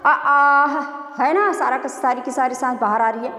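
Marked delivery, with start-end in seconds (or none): 0.1-0.7 loud open "आ", all the breath let out; a breathy, open "आ"; long breathy aa. Shown as a demonstration. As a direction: push "आ" out with full breath. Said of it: "पढ़ के practice करते हैं".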